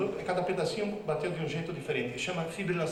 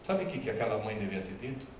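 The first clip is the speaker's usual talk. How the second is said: ordinary talk, nothing marked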